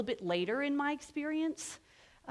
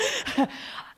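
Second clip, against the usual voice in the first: high laugh; 13 semitones higher than usual. Real, not acted. Embarrassed by the naming, a high laugh.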